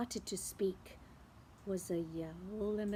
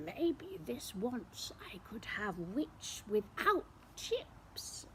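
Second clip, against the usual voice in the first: whispered, sly dragon; menacing whisper; 7 dB quieter than usual. Acted. Dragon about to eat the witch, whispered. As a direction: hiss it greedily under the breath.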